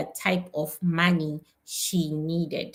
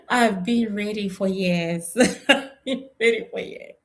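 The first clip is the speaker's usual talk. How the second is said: real laugh breaking up the words; words squeezed out between bursts of laughter; 3 semitones higher than usual. Real, not acted. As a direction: laugh through the admission. Laughing as she admits being ready.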